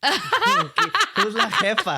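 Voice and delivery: high, slow, laughing drawn-out question; laughing tease. Real, not acted. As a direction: stretch it out high through a laugh.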